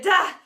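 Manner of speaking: loud exertion grunt; sudden high cry of effort. A narrator's reading. Teacher leaps onto ping pong table, effort cry.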